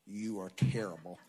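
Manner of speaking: low, blunt verdict; voice of circumstances. Retold or imitated. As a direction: drop low to deliver a damning answer. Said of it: "Natural circumstances".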